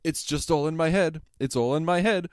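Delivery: loud panicky muttering to himself; retold panicked reassurance; scared self-talk. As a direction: talk yourself out of the fear, loudly. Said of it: "and he's like".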